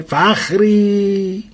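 hollering for the wife across the house; stretched mock call from the other room. Father calling out to wife.

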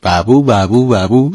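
urgent mock siren; quick repeated siren imitation; siren wail.

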